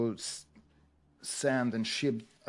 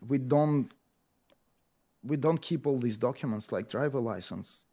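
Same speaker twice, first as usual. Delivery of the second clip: ordinary talk, nothing marked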